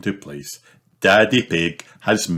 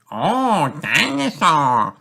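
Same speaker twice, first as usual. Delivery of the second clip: put-on high, drawn-out toddler voice; little one awed by a toy, slow and high; George; 10 semitones higher, slower than usual. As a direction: say it like a delighted small child, high-pitched. Little George voiced seeing a dinosaur.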